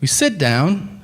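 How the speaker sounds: ordinary talk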